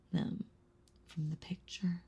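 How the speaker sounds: chilling soft whisper. Acted. Vampire finishing her threat, chilling whisper.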